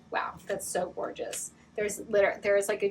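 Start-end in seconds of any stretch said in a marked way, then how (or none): 0.1-1.5 breathless whispered wonder; awed whisper. Real, not acted. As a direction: whisper it in awe. Awed reaction to a crystal.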